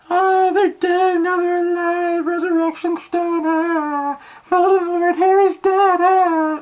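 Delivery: very high, slow, sing-song mock wailing; imitating mom bawling. Mocking his mom sobbing through the movie, in a high wail. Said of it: "she was bawling".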